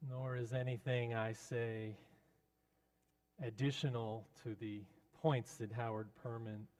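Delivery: ordinary delivery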